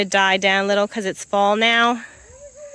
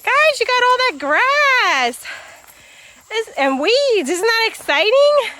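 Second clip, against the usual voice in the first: higher, slow sing-song voice; animal talk; 9 semitones higher than usual. Real, not acted. Farmer showing goats their new pasture, high slow pet talk. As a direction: show the goats their grass like a treat.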